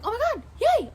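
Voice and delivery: high, loud retold squeal of joy; acted excitement. Streamer re-enacts seeing the hummingbird wake up, excited cheer. Acts out joy at bird waking.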